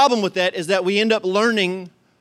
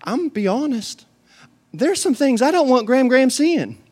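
wry inner thought; 7 semitones higher, 4 dB louder than usual. Retold or imitated. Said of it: "I think to myself".

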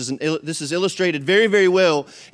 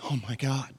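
soft, hushed frightened gasp; trembling fear; 9 dB quieter than usual. Shown as a demonstration. Servant seeing the army, quietly terrified. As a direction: breathe it out in terror. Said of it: "You can hear the trembling".

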